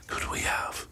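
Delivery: hushed, conspiratorial whisper; a dramatic whispered hope for a thrilling finish. Acted. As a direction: whisper it like a secret. Wrap-up, teasing a tense finish.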